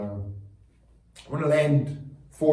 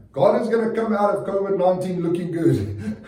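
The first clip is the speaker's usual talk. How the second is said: laughing declaration; 6 semitones higher, 4 dB louder than usual. Real, not acted.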